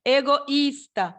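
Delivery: slow teacher demo of the hiatus in "Egoísta", O then stressed I; separated I. Shown as a demonstration. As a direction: stretch "Egoísta" with a strong separate I. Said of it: "O i também".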